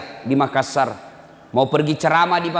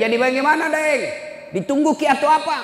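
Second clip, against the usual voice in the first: pressing question; 13 semitones higher than usual. Retold or imitated. Preacher pressing the son.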